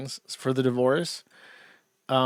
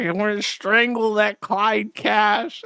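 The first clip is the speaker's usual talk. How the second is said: slow mock threat in a lightly put-on voice; a hint of a sulky man, slow and put-on; imitating Chris Chan; 5 semitones higher, slower than usual. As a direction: drag it out like a petulant empty threat. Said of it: "talking about Clyde Cash".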